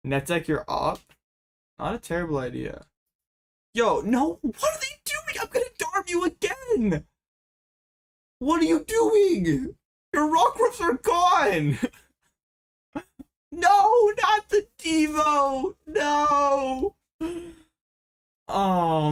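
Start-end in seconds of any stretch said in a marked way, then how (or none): 3.7-9.7 very high falsetto shrieking; panicked squeal. Real, not acted. Opponent's play, high-pitched panic. 10.1-11.8 high shriek. Real, not acted. Same words, now shrieked. 13.6-16.9 high, loud anguished wail. Real, not acted. Devolution played, anguished outcry.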